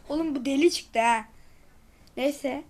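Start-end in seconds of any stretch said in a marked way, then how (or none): none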